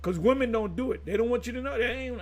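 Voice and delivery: normal talking voice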